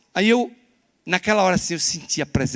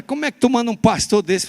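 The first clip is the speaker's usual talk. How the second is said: retold outburst; 9 semitones higher, 8 dB louder than usual. Retold outburst during the prayer.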